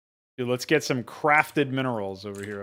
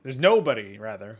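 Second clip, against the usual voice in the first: ordinary talk, nothing marked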